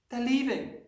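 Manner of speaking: put-on high child's voice; distressed cry. A narrator's reading. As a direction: blurt out the bad news. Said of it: "she cried".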